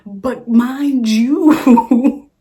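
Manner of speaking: loud, very drawn-out wail; exasperated outburst. Real, not acted. Storyteller exasperated by her date, loud drawn-out exclamation. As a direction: stretch every word out loud and high. Exasperated build-up about date's stutter.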